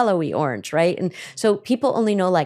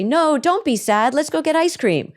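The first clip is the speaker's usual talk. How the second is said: put-on jolly a sad child out of it; dismissive parent; 8 semitones higher than usual. Parent brushing off a sad child, high and sing-song. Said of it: "And the parent would say".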